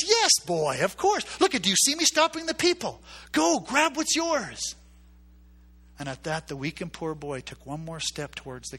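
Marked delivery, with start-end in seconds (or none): 0.1-4.8 jovial booming king. Retold or imitated. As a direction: urge the boy on like a generous king. King urges the boy.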